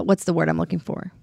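normal talking voice

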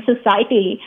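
usual speaking voice

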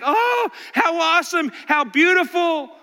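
mock gushing admiration; admiring guests. Visitors mock gushing over a vase. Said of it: "and is just like".

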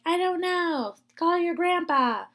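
high-pitched mock mom voice; mom impression. Daughter asks parents for help, mom impression. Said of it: "That's what my mom sounds like, clearly".

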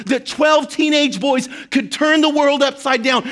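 Jesus's encouragement preached loud and high; impassioned preaching. Acted. Preacher voicing Jesus to the congregation, impassioned and loud. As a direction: pour belief into them at full force.

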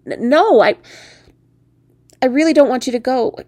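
loud, high stammered protest; pleading. Acted. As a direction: plead with someone not to leave. Begging the frog to stay.

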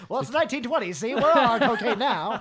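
high, loud, laughing barkeep; pushy bartender voice. Pretend. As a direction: push drinks like a wired bartender. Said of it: "pushiest bartender ever".

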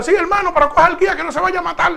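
retold frightened high plea; alarmed plea. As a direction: imitate the other brother begging him to grab the wheel. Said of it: "el otro hermano le dijo".

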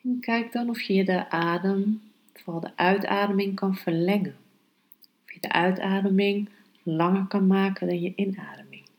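calm, hushed meditation guidance. Acted. Leads a meditation, breath focus. (zachte, rustige meditatiestem)

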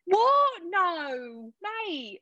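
imitating Keira Knightley's put-on London accent; mock London accent. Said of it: "Keira Knightley's accent hilarious".

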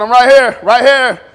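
loud, insistent calling; God calling out. Acted. God calling to people who ignore him, loud. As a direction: call out to be noticed. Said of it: "And God said".

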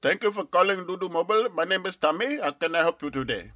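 chirpy customer service voice; call center agent. Acted.

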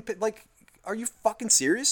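ordinary talk

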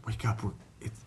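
soft half-whisper, imitating a sister; hushed waking voice. Big sister waking younger brother for dinner, hushed and gentle. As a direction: rouse a sleeper gently, barely voiced.

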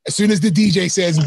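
normal talking voice

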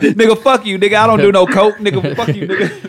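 loud playful outburst; mock angry shout. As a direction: yell it back, half laughing. Joking retort about coke.

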